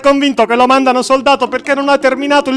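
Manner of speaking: a clerk rushing in panicked to his superior; alarmed report. Acted. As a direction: blurt it out in a panic to the boss.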